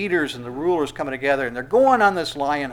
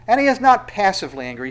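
NOT punched, quick and higher; fast, emphatic sermon point; stress on not; 6 semitones higher, 5 dB louder, faster than usual. Acted. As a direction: hit the word not hard and fast. Preacher stressing God's anger.

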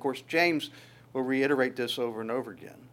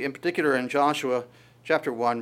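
usual speaking voice

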